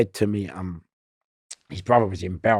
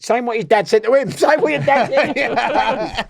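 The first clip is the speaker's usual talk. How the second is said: mock a scared officer in a high voice; mocking whiny voice; 5 dB louder than usual. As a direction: squeak it out to mock him.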